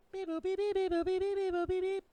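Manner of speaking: high electronic beeping; robot beeps. Pretend. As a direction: beep it like a machine. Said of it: "I made that noise".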